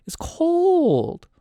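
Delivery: "cold" held very high and loud; high-pitched cry. Real, not acted. Game master stressing the northern chill, hammed-up high wail.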